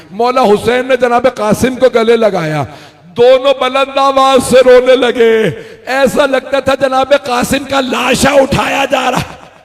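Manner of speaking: slow, loud, grief-stricken preaching; wailing lament. Acted. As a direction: cry it out slowly to the mourners. Lamenting sermon climax.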